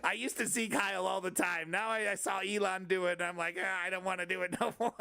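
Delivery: high, griping comic dictator; Adolf Hitler. Acted. Dictator complaining that Elon copied him, high sulky mock voice. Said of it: "It's like Adolf Hitler".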